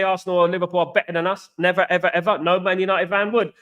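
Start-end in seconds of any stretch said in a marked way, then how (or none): none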